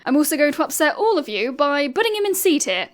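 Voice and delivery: usual speaking voice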